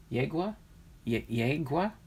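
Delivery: "Yegua?" rising as a question; puzzled, questioning "Yegua"; unsure pronunciation. Shown as a demonstration. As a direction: try "Yegua" as YAY-gwah, lifting at the end. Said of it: "Is that what it is?".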